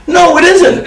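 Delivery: high-pitched exasperated denial; retold contradiction. Arguing with Bible literalists, exasperated retold contradiction. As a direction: contradict them, pitched well up.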